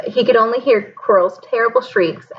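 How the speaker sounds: ordinary delivery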